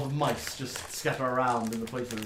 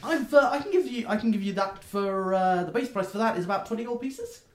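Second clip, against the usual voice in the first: much higher, eager question; chirpy gnome; 7 semitones higher, 6 dB louder than usual. Acted. Gnome checking the order with the customer, squeaky high. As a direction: ask it like a delighted shopkeeper.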